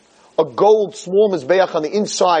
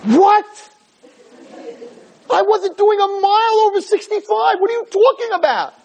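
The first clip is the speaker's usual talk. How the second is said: much higher, outraged denial; fake indignant surprise; 12 semitones higher than usual. Father lying to trooper, high mock-outraged denial. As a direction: play innocent and shocked at the ticket.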